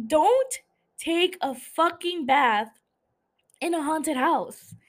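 high, slow, word-by-word telling-off; exasperated scolding. Pretend. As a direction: scold her slowly, pausing for emphasis. Scolds the character Vivian directly.

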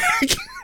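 high squealing burst of laughter. Real, not acted.

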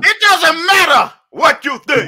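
slow, loud, high mock shout. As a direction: bellow it in jest. Mock bossy outburst at friends.

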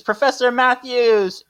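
high, drawn-out urgent call. Acted. Student bursting into her professor's office, calling out high and urgent. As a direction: call out for the professor in mock alarm.

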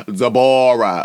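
"Deborah" as duh-BOR-uh, loud; imitated pronunciation. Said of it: "the way that she be pronouncing shit".

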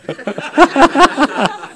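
a high burst of laughter at the joke; high tickled laugh; amused laugh. Real, not acted. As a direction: crack up at the memory. Preacher laughs at the prophecy.

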